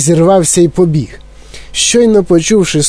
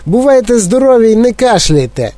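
cheeky shout; 7 semitones higher than usual. Acted. Mike shouting goodbye before jumping. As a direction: toss it off recklessly. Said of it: "вигукнув він".

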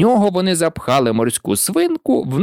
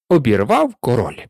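plain reading voice

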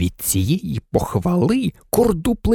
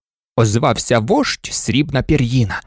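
usual speaking voice

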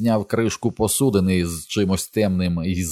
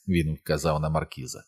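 ordinary delivery

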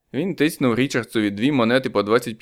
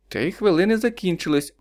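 higher, official operator voice; 8 semitones higher than usual. A narrator's reading. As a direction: cut the call off formally, higher. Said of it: "урвала їх телефоністка". (телефоністка, вищим офіційним голосом)

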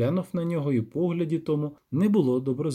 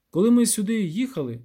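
lightly voiced villager, higher pitched; villager storyteller; 7 semitones higher, 3 dB louder than usual. Villager explaining to the witcher, lightly acted peasant voice.

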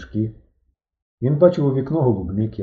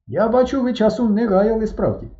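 character voice; 12 semitones higher than usual. Acted. Square 103 speaks.

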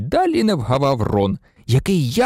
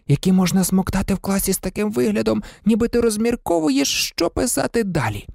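lightly voiced boy, higher, gushing; Ron raving about sweets; 8 semitones higher than usual. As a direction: list the treats like a kid in a sweet shop. (захоплений хлопчачий голос, вище)

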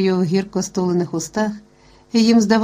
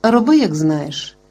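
ordinary delivery

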